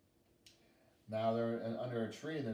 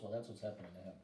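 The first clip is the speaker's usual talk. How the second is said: ordinary delivery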